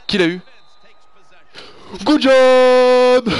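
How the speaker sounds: high, loud cheer; ecstatic cheer. Real, not acted. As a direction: explode with joy. Celebrating the recovery.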